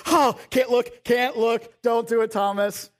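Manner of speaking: loud, high mock cry; panicked disciples. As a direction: shout it like you can't bear to watch. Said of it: "the other disciples were like".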